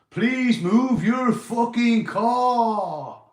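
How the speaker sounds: slow, drawn-out high yell; MC shout. Retold or imitated. Mimicking Morty's old club mic call, loud drawn-out yell. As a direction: bellow it like an MC on the mic. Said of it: "You'll remember that one, Morty".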